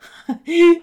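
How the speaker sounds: loud, high gloating bird cackle; the owl roars with laughter at the fallen horse, loud and high; owl laughing. Acted. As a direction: cackle with full-throated glee. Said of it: "Der Uhu lachte aus vollem Halse".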